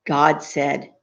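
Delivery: ordinary delivery, nothing marked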